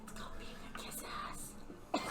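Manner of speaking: very soft, breathy whisper; whispered aside. Pretend. Player sniping at an eager newcomer, whispering. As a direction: whisper it behind their back.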